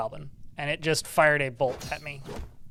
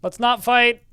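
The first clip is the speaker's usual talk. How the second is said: higher, louder plea to stop fighting; pleading call; 8 semitones higher, 6 dB louder, slower than usual. Real, not acted. Player begging the betrayed ally to stop fighting, raised plea. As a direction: try to calm the ally down.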